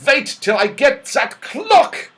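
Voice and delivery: high, loud furious yell at the clock; angry shout. A narrator's reading. Dame Snap shouting after the runaway clock, furious. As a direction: bellow it like a cross old dame. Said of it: "came in grumbling".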